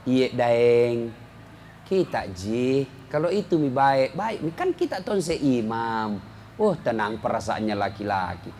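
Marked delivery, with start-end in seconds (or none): 0.0-6.2 lightly voiced sweet, lower wife's reply; a hint of a gentle, respectful wife; polite wife. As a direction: answer the husband meekly and politely. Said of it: "Coba kalau bicara ki sopan".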